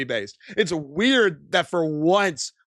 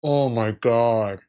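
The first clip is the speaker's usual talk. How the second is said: sarcastic; 7 semitones lower than usual. Real, not acted. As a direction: fawn over him in sarcasm, lower. Sarcastic praise of old man.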